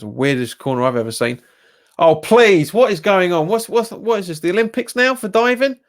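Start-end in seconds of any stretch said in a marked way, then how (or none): 2.0-5.8 sarcastic disbelief. Real, not acted. Mocking a player's dive.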